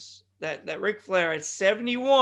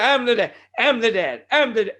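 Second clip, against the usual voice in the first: mock celebrating; 3 semitones higher, 7 dB louder than usual. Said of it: "he starts dancing around".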